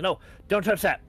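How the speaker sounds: high, loud desperate shout; frantic cry to stop them; struggling shout. Acted. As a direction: yell in panic while struggling. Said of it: "still hear him struggling".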